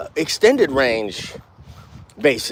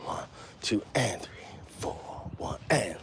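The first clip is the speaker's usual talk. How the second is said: whispered count of beats; hushed rhythm count; 3 semitones lower, 16 dB quieter than usual. Shown as a demonstration. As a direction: breathe the count under your breath. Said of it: "the and of beat one".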